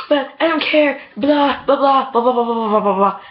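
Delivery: high-pitched yammering, easing down as it runs on; mock nagging. Mom nagging her eardrums out, mock yammering. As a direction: imitate someone nagging on and on. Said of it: "nagging my eardrums out".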